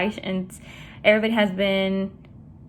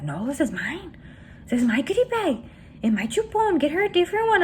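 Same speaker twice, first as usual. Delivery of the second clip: mock possessive protest climbing higher; stingy dad; 7 semitones higher than usual. Retelling dad refusing to share, stingy mock protest. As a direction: imitate dad refusing to share, pitch rising.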